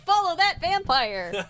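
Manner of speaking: put-on eager kid shout; excited shout. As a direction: call it out like a thrilled kid. Kids chasing the vampire.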